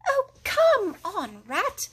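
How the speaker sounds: high, slow, dragged-out whine; impatient. A narrator's reading. Mole waits for digging Rat, impatient. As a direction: nag him to hurry up. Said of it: "while the mole waited impatiently".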